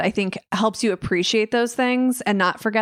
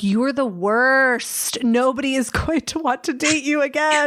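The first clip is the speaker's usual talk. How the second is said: mock self-scolding; 4 semitones higher than usual. Telling herself off during a bad blind date, mock dismay. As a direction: stretch it out in exaggerated self-disgust, breaking toward a laugh. Mocking herself in a retold thought.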